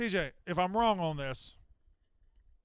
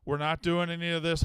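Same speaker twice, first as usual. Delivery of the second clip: ordinary talk, nothing marked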